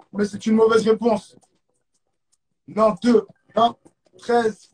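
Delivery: usual speaking voice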